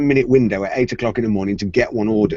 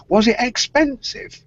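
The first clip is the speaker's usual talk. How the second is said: high, drawn-out imitation of nosy awe; impressed onlookers; 11 semitones higher than usual. Bank colleagues asking wife about the phone, impressed. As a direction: ask slowly in mock wonder, pitched way up. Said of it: "They're saying".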